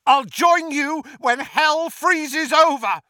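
loud, high, defiant refusal; Neville. A narrator's reading. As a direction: hurl the refusal at the villain, drawn out. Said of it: "said Neville".

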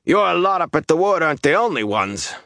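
rough, high-pitched jeer; loud, rough voice. A narrator's reading. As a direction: throw it out roughly for all to hear. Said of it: "said in a loud, rough voice".

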